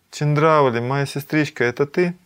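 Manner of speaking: high-pitched, excited question to a sister; amazed recognition. Acted. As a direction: call out to a long-lost sister. Radha recognizes her sister on reviving.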